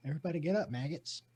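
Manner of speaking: soft-spoken drill sergeant; Bob Ross. Acted. Bob Ross waking recruits as drill sergeant, softly attempting to yell. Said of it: "but now with the new Bob Ross".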